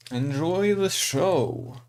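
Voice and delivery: slow, drawn-out showman call; announcer voice. Acted. Stream opening announcement.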